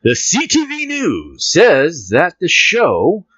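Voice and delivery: reading the news to the listeners, playful announcer; mock news read. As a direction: announce the story grandly, slowly. Reads news article aloud.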